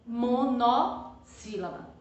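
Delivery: "monossílaba" slow, mo, no, then sílaba; broken into parts. Shown as a demonstration. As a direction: separate "mo" and "no" in "monossílaba". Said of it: "Preste bem atenção".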